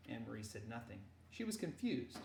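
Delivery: usual speaking voice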